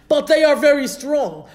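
loud, high-pitched retold objection; emphatic protest. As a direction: raise pitch and volume, voicing the people's protest. Spies' protest to Moshe, retold.

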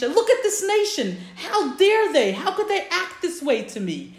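high, loud indignation in retelling; dramatised affront, pitched well up; indignant outrage. Retold or imitated. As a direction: act out an outraged complaint about the people. God angrily complains to Moshe.

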